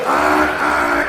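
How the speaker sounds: gasps. Pretend. Organs failing.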